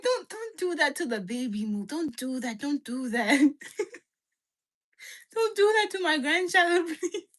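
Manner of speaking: put-on high, laughing Nigerian dad accent; a hint of Nigerian accent, pleading through laughter. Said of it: "Your dad sounds Nigerian".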